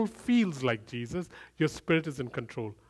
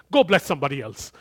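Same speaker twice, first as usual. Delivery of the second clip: louder, curt brush-off; dismissive retort; 9 dB louder, faster than usual. Retold or imitated. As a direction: throw it back at him. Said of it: "shook my hands away and said".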